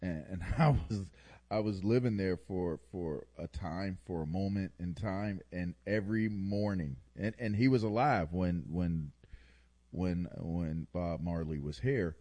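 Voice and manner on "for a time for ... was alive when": usual speaking voice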